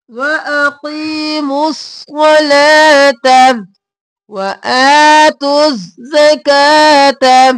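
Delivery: drawn-out melodic recitation; slow recitation. Shown as a demonstration. Teacher models the phrase for students, slowly recited. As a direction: stretch each syllable slowly. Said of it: "अब यहाँ पर वआ किया".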